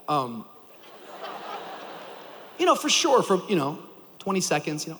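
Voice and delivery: ordinary delivery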